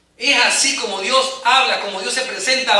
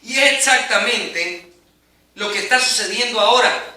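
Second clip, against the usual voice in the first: ordinary delivery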